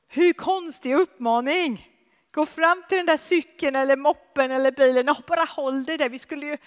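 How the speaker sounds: high, loud impassioned preaching; a preacher building to a climax, high and loud; full-force preaching. Acted. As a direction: lift it and drive it home with fervour. Emphatic preaching.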